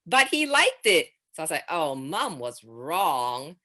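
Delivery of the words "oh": normal talking voice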